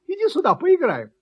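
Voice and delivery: high, loud invitation to play; eager puppy shouting to a friend; calling out. A narrator's reading. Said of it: "позвал щенок". (громко, высоко зовёт играть)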